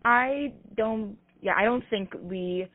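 ordinary delivery

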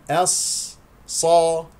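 "الص" held on the ص, then "صا"; doubled heavy ص. Shown as a demonstration. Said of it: "صاد مشددة". (نطق "الص" بصاد مشددة مفخمة)

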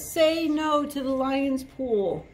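elephant protester. Acted. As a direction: voice the elephant marching with a sign.

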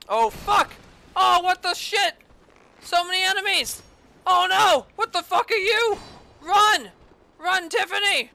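high, loud frantic yelling; panicked shouting. Real, not acted. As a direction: shout in panic while fleeing. Swarmed by enemies, fleeing.

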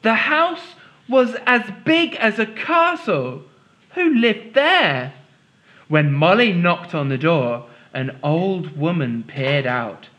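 lively read-aloud storytelling voice; story reading. A narrator's reading. Teacher reads story aloud.

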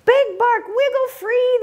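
high, eager little rescuer's cry; hammed-up shout. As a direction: call up to him to break loose. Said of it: "the prairie dog shouted".

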